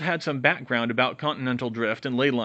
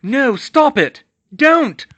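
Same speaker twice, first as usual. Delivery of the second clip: high, very loud screams of protest; dragged away, crying out to friends in panic; desperate cry; 12 dB louder, much slower than usual. Acted. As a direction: scream for them to stop. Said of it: "she cried".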